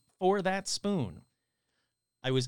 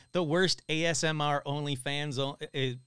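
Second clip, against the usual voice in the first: ordinary delivery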